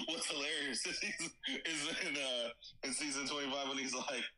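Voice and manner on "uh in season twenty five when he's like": normal talking voice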